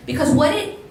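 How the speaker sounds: ordinary delivery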